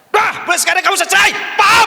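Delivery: high, loud furious yell; raging shout. Retold or imitated. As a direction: scream it out in blind rage. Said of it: "Dia marah besar".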